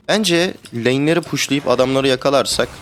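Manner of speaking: ordinary talk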